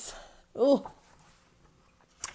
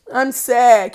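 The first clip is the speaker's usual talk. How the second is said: ordinary talk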